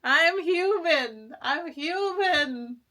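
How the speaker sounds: slow tearful protest; tearful plea. Real, not acted. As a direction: insist you're only human while crying. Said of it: "I have tears".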